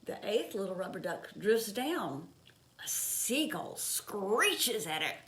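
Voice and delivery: put-on gentle read-aloud pace for children; storybook reading. A narrator's reading. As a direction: read it like a picture book at bedtime. Reading picture book aloud.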